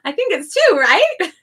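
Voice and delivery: laughing, squeaky high What? then a giggling two; mock confusion through laughter; laughing disbelief. Teacher retelling a student's syllable count, laughing. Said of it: "I'm like".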